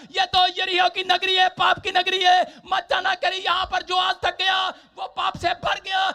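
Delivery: loud, high, driving sermon delivery; full-force preaching. Acted. Preacher warning Jesus away from the sinful city, full-force preaching. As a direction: preach it out in urgent repeated warnings.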